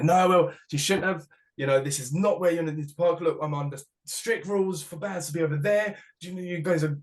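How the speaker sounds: hammed-up Welsh official laying down the rules; imitating an indignant Welsh organizer; Welsh organizer telling off. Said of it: "in a proper Welsh accent".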